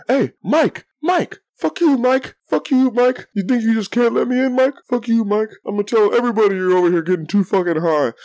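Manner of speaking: high-pitched imitation of a ranting street yeller; retold screaming. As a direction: rant up at a window, voice pushed high. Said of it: "screaming for a good five, ten minutes".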